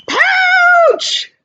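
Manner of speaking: teacher voicing scared Joey fleeing to the pouch; frightened cry. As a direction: yelp it and dive for mama. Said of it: "said Joey".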